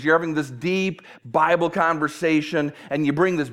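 normal talking voice

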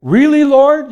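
high, loud startled question; astonished. Acted. Barnabas answering the Lord, loudly astonished. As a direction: caught off guard by God's request. Barnabas hearing God's call.

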